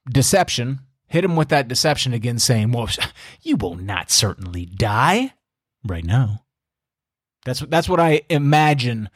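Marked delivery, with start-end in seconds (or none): 2.7-6.4 slow, low, mocking chuckle through the words; scoffing serpent. Serpent scoffing at the woman's fear of dying, slow and mocking. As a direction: sneer it like a sly tempter brushing off death. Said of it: "It was scoffing at the idea".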